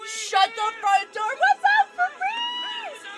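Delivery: high, loud surprised greeting; excited shout. Real, not acted. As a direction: yell it out delighted and loud. Surprised raid greeting to friend.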